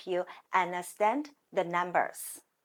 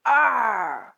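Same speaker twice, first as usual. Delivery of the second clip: louder squawking parrot er; mock-angry parrot cry; 6 dB louder than usual. Shown as a demonstration. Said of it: "Imagine you are parrot. When you're angry".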